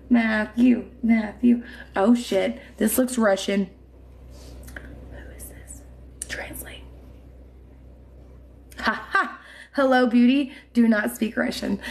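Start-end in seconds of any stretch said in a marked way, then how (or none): 5.1-6.8 unvoiced breathy whisper. Real, not acted. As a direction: whisper to yourself. Whispered aside.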